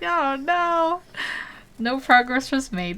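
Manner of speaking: high, put-on "oh no" lament; mock dismay. Streamer mimicking viewers over lost progress, mock dismay. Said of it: "Everyone's like".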